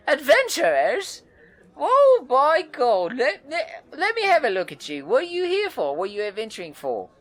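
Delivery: very high, loud, bubbly woman's voice; excited red-haired woman. Acted. Wiry red-haired woman greets the adventurers, very high and thrilled. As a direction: bounce in thrilled to meet them. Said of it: "excitedly beside you and says".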